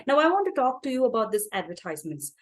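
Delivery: ordinary delivery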